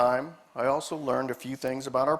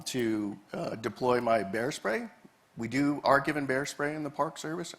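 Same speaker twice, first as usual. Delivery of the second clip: ordinary talk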